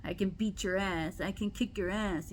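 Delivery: understated swagger; acting tough. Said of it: "real tough".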